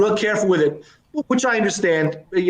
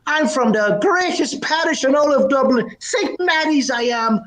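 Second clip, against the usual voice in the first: proud Dubliner, pitched up; Irish brogue; 7 semitones higher than usual. Acted. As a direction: boast in a high Irish brogue. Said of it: "a bit of a brogue".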